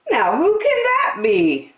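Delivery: high, kindly park keeper; a gentle, fussy park keeper; Percy's voice. A narrator's reading. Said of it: "thought Percy".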